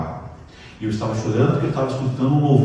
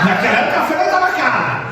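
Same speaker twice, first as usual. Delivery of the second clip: much higher, chuckling; laughing aside; 10 semitones higher, faster than usual. Real, not acted. Preacher laughing to the church about cheap coffee. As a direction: joke it through a laugh.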